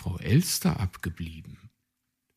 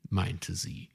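plain reading voice

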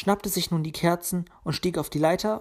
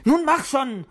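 high, louder pushy urging; impatient; 9 semitones higher, 6 dB louder, slower than usual. A narrator's reading. Luzi interrupts Puh's dreaming, impatient. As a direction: hurry him along impatiently. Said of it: "unterbrach sie ungeduldig".

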